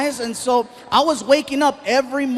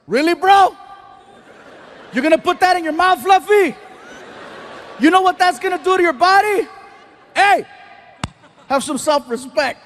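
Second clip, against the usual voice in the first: high, loud mock scolding; yelling friend; 7 semitones higher, 3 dB louder than usual. Friend yells at him over a muffin at the buffet, higher and louder. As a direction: shame him in front of everyone. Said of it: "he starts yelling in front of all the people".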